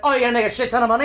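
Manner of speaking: fast, eager mock parent; parents suddenly pleased. Retelling the parents hearing about the money, fast and eager. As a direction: gush at the money quickly. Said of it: "until they're like".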